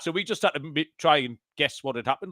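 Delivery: ordinary talk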